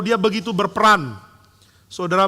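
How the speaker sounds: ordinary delivery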